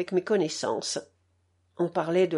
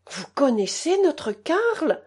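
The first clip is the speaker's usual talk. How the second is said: surprised question; 7 semitones higher, slower than usual. A narrator's reading. Mother hears of her son.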